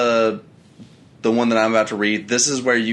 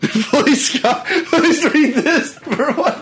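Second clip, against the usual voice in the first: high, slow pleading through laughter; laughing; 4 dB louder, much slower than usual. Real, not acted. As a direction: beg through helpless laughter. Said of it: "kills me".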